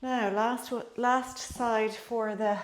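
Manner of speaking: usual speaking voice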